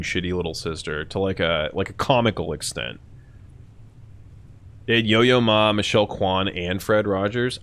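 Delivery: usual speaking voice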